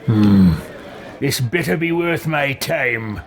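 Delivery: grudging in-character warning; hum, then grumble it in character; impatient game hero. Acted. Player voices impatient game character.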